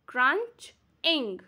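Crunch-ing broken as crunch, pause, ing; two separate beats in Crunch-ing; split into syllables. Shown as a demonstration. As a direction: sound out Crunch-ing in parts. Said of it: "two syllables".

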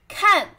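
看 with a sharply falling fourth tone. Shown as a demonstration. Teacher drilling a word for learners, falling tone on 看. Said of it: "first turn".

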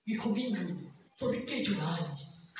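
put-on old man asking the boy why he cries in low voice. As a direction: speak like a kindly bearded elder. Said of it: "le preguntó".

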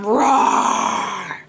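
slow drawn-out growly roar; dinosaur roar. Acted. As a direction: roar like a T-Rex for the kids. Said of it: "He's going".

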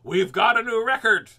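loud, high mock cry of triumph; imitating excited nurse. Imitating the nurse at his covid test, loud triumphant cry. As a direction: shout it like a nurse cheering a record. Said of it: "the nurse is gonna go".

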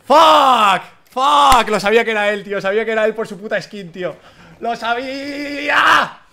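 loud, high-pitched rage at losing; furious yelling. Real, not acted. Gamer who knew who would kill him, furious yelling. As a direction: curse and yell, stretching the last cry. Furious after dying in game.

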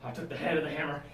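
ordinary talk, nothing marked

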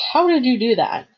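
high, loud astonished question; amazed. A narrator's reading. Boy asking his friend how she tamed the monster, amazed. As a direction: ask in open amazement.

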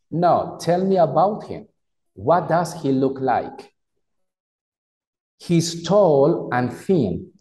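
slow, carefully pronounced English; slow model reading. Shown as a demonstration. Teacher reads the dialogue to students, slow and careful. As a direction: read each word out slowly for learners. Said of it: "Voy a pronunciarlo".

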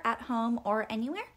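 usual speaking voice

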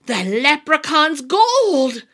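slow, loud amazed gasp. A narrator's reading. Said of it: "gasped old Pat".

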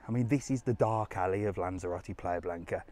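usual speaking voice

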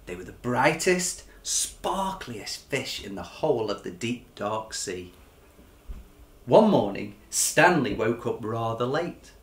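lively, expressive picture-book reading; story narration. A narrator's reading. Author reading his picture book to children, lively storytelling. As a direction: read it like a bedtime story for kids.

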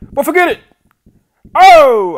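high, very loud yell; excited outburst. Real, not acted. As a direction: shout out as the shot nearly drops. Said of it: "I got excited".

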